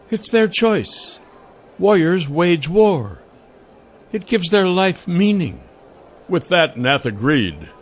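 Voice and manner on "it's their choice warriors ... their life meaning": Celine voice. A narrator's reading. Celine firm reply.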